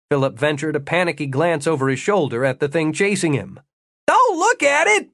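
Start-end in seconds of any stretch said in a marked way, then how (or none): 4.1-5.1 high, loud, urgent yell; shouted command. A narrator's reading. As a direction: yell it in alarm, pitch way up. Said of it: "Jimmy shouted".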